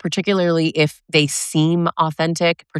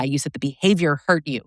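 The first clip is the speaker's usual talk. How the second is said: ordinary talk, nothing marked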